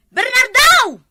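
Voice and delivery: high, loud shout of his name; calling out. Acted. Wife calls for her missing husband, loud high shout. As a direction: yell for him across the yard.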